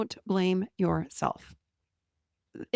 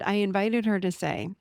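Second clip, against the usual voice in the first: ordinary delivery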